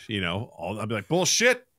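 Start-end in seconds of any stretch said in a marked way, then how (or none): 1.1-1.6 higher, louder incredulous call-out; mock outrage. User calling out AI's made-up history, mock outraged. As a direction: blurt it as a hammed-up challenge.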